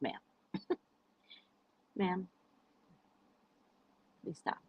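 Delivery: very soft, hesitant plea; retold hushed appeal; meek restraint. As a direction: ask politely, barely audible. Retold restrained reply to angry woman.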